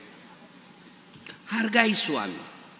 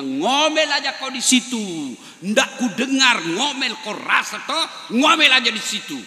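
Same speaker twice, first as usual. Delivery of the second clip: mocking taunt; 6 semitones higher, 3 dB louder than usual. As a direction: jeer down the well at her. Husband taunts wife down well.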